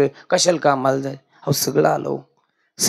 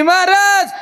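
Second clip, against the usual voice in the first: high, crying plea to the preacher; pleading woman; 5 dB louder, slower than usual. Retold or imitated. Sister weeping to the maharaj, high pleading call. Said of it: "म्हणली".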